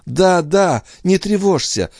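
high, fervent outpouring; emphatic gratitude. A narrator's reading. Andrea thanking her brother Philippe, high and emphatic. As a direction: stress the words with pitch held high. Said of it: "подчёркивая голосом каждое слово".

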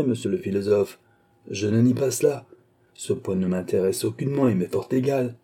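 usual speaking voice

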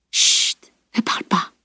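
hushed, whispered soothing; murmur. A narrator's reading. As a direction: gently shush a wounded friend in a whisper. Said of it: "murmura Edwin". (murmure, presque sans voix)

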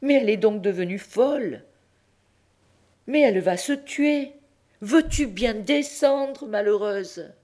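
high pleading call up the tower; frantic old pope. Acted. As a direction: call up to her, frightened. (le pape supplie la mule d'une voix très aiguë)

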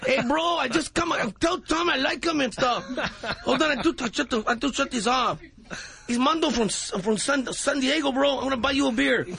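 Mondo impression. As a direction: talk like a spacey San Diego bro. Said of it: "this is Mondo".

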